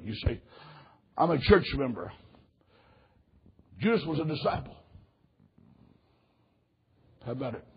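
hushed, low invitation to the congregation; quiet, solemn pastoral appeal; altar call. Real, not acted. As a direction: ask it softly, with long pauses. Leads altar call, heads bowed.

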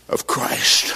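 whispered. Acted. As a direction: drop to a whisper on the last words. Dramatic pause before key word.

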